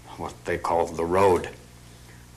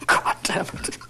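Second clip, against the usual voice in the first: ordinary talk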